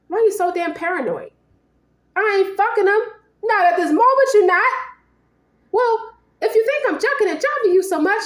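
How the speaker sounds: high, heated comeback; mock-outraged lover in a fight; acted indignant quarrel. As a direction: argue back hotly, pitch way up. Acted couple's quarrel over cheating.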